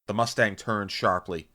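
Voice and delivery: usual speaking voice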